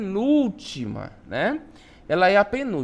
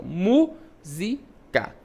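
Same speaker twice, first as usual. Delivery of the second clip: "Música" drawn out syllable by syllable, weight on "Mú"; stress on first syllable; much slower than usual. Shown as a demonstration. Teacher repeating the example for pupils, "Música" split with "Mú" stressed. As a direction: say "Música" very slowly, stressing the opening "Mú". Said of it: "mu é pronunciado com mais".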